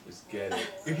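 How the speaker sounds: ordinary talk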